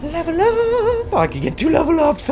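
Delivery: high, loud celebratory squeal; elated. Real, not acted. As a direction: shriek with joy at the reward. Elated at levelling up.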